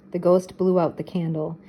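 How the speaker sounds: ordinary delivery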